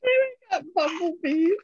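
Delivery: high, delighted little voice. Real, not acted. Crafter showing off her bumblebees, delighted.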